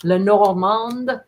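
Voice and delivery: Lenormand slow with a French touch, final d sounded; French accent. Shown as a demonstration. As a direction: say Lenormand slowly like a French word. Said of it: "is it a French word".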